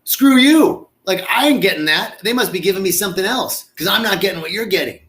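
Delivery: loud, high, resentful outburst; hammed-up frustrated guest yelling; mock indignant. Guest who felt nothing, loudly indignant. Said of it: "the other people are like".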